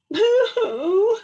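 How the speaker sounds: high, loud boo-hoo wail; mock crying. A narrator's reading. Little Beaver crying by the pond, high mock wail. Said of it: "he said".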